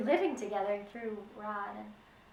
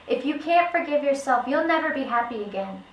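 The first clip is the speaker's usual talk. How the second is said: loud; 3 semitones higher, 8 dB louder than usual. Acted. Widow urges him to forgive.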